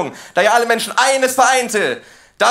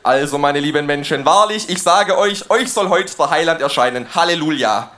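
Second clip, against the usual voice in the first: preachy; 7 semitones lower than usual. Pretend. Mock sermon opening.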